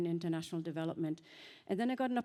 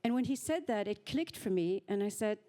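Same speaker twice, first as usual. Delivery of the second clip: ordinary delivery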